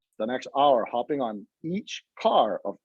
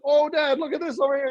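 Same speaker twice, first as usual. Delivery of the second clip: mock sister voice; 12 semitones higher than usual. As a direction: play the sister sweetly distracting Dad. Sister distracting dad, voiced by teller.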